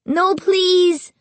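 high, pleading terrified cry. A narrator's reading. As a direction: beg in terror. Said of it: "he cried".

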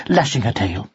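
plain reading voice

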